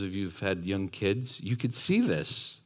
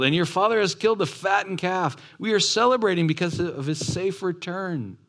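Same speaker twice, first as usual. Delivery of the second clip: excited news; 9 semitones higher than usual. Acted. Servant telling the older brother the feast news. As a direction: share it like wonderful news. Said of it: "an excitement running by and sharing".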